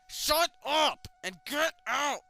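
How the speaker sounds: high, loud, slow furious scream; mom screaming. Acted. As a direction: mom yells you out of her room. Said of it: "my mom screams".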